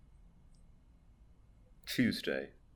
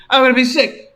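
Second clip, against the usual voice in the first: queasy plea; 6 semitones higher, 4 dB louder than usual. Acted. Captive voiced in fanfic.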